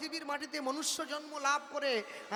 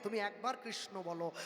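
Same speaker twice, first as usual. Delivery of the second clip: softer and lower "তুমি একবার কৃষ্ণ বলো"; hushed, humble plea; soft pleading; 6 semitones lower, 6 dB quieter than usual. Retold or imitated. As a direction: plead gently and low. God begging at the door.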